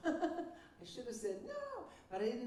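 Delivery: a rueful laugh through the words. Real, not acted. Looking back at her own mistake, laughing.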